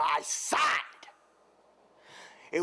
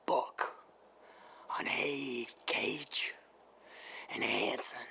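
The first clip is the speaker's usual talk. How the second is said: low threatening whisper; menacing whisper; 3 semitones lower, 9 dB quieter than usual. Acted. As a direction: hiss it close to the mic.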